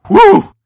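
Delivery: sharp high exhale of awe; loud whew. Real, not acted. Reacting to brutal movie.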